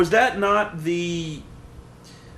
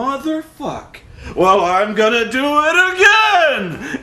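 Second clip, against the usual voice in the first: higher, louder, slow indignant vow; hammed-up frustrated fighter; 7 semitones higher, 5 dB louder, slower than usual. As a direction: play a sore loser who swears he'll try again. Mocking the frustrated movie fighter.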